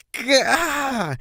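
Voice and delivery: high yelp of pain; shriek of pain. Acted. Colt to his brother, high pained shriek. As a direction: yelp as your mane is ruffled. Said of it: "Rumble shrieked in pain".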